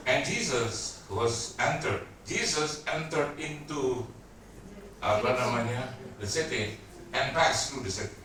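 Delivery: slow, deliberate English reading. Shown as a demonstration. Preacher reads the English verse to the church, slow and deliberate. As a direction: read the English verse slowly. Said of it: "Itu terjemahannya bilang gitu".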